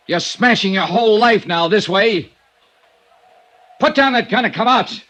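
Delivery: ordinary talk, nothing marked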